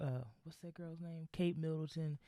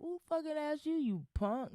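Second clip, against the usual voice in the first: indignant retort; 14 semitones higher than usual. Real, not acted. Rant at Piers Morgan.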